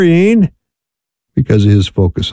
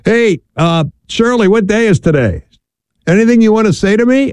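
playful calling-out, pitched up; retold calling over; 9 semitones higher than usual. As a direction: call over to a wife in a raised pitch. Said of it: "I'd yell over".

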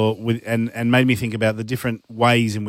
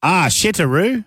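higher, rising Japanese phrase in Australian vowels; Australian accent; 6 semitones higher than usual. Shown as a demonstration. Host repeating Japanese for the co-host in an Australian accent. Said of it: "an Australian accent".

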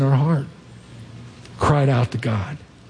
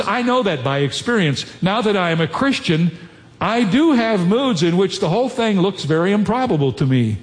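quotation reading; 5 semitones higher than usual. Acted. Pastor reading C S Lewis to the congregation, measured. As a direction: read the Lewis passage with weight.

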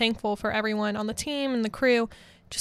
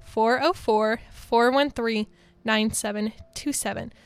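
phone number slowly, in separate groups; slower dictation. Real, not acted. DJ repeating the number so listeners can type it, slower. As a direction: read out the number slowly, pausing between groups. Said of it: "say that slower so you can type it".

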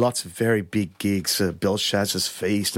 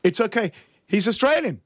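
retold concertmaster announcing; 11 semitones higher than usual. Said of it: "he said, in French".

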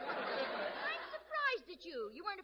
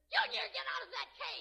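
higher-pitched scolding yell at her son; mother's shout; 7 semitones higher than usual. Acted. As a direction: yell the kid out of the cage. Mother yells at child in cage.

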